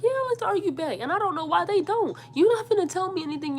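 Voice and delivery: high, fired-up comeback; heated retort. Retold or imitated. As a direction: argue back with rising heat. Arguing back at her mom.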